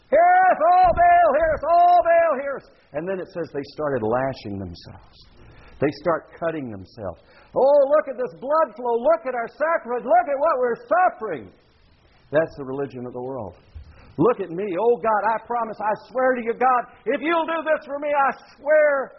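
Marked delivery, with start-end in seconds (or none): 0.1-2.7 loud, very high repeated wail; frantic mock screaming. Dancing prophets beg Baal to hear, mock screaming. 7.5-11.5 high-pitched, hammed-up begging; desperate wailing plea. Self-cutting prophets show Baal their blood, mock wailing. 14.2-19.1 high pleading, climbing as it goes; desperate bargaining. Acted. Worshipper promises God anything for a favor, mock pleading.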